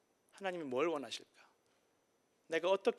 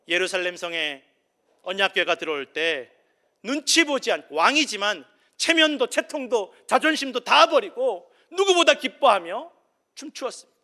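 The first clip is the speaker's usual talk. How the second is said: much higher and louder, slowed with joy; ecstatic preaching; 6 semitones higher, 15 dB louder than usual. Acted. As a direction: shout the joy of the dancing king. Ecstatic preaching about David dancing.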